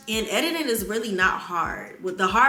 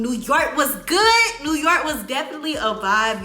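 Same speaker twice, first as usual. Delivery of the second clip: gushing; 6 semitones higher, 6 dB louder than usual. Real, not acted. Vlogger raving to viewers about New York, gushing. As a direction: relish every word of the trip.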